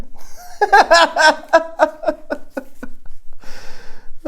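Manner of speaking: long, high cackling laugh; cackle. Acted. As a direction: let a cackle run on and fade. Laughs at own moral.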